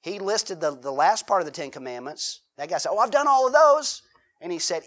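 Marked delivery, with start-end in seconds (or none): 2.9-4.0 high, loud proud claim; young man boasting to Jesus that he has kept them all; boastful young man. Acted. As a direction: brag that you kept every rule.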